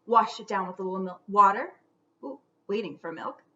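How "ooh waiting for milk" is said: ordinary delivery, nothing marked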